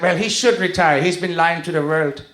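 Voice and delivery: imitated German accent; German mom. German mom judging the retired Pope, German accent. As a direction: play a disapproving mother with a German accent. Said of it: "my mom is German".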